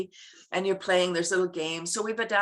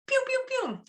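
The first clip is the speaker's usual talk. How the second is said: a much higher, sound-effect pew repeated; playful zap noises. Shown as a demonstration. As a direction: fire off little happy hormone zaps. Hormone release sound effect.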